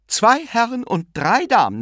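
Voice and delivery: bustling aunt calling out. A narrator's reading. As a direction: announce it over the crowd.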